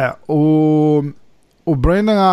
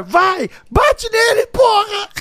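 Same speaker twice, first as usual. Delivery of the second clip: high, slow yell from cornerside; shouting; much slower than usual. Retold or imitated. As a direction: yell it like a wife cheering a fight.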